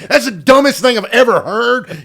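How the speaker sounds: high, loud blurted put-down; blunt outburst. Acted. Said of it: "how do I talk without being an asshole".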